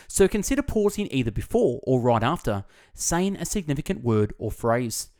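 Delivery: ordinary talk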